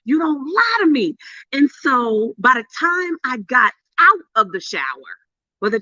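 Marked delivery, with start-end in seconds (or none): 0.0-1.2 high, fervent cry to God; mock scream, pitched up without extra volume; retold screaming. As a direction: re-enact a joyful scream at a much higher pitch. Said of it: "I was screaming 'cause I was like".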